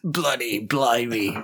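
hammed-up English accent, bloody and blimey played up; a bad tourist take on an Englishman; put-on English accent; mock English accent. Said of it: "now you went from Australian to English".

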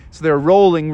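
ordinary delivery, nothing marked

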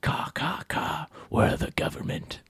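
soft, breathless crow whisper; whispered caws. Pretend. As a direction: whisper like a sinister bird. Whispered bird conspiracy.

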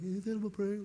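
lightly done meme voice mocking advice; mocking SpongeBob meme. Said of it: "the SpongeBob, like, meme".

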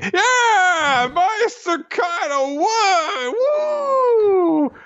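squeaky, very high, loud goon cheer; a gleeful cartoon henchman whooping; cheering pirate goons. Beast Pirate goons cheering for Kaido, squeaky and gleeful. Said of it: "beast pirates are like".

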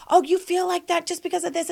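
high, dismissive mock retort; imitated projecting person. Host mimics someone not listening, dismissive. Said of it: "someone else is projecting and they're saying".

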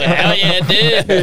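high loud mock drunk cheer; mock Carl. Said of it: "it's like".

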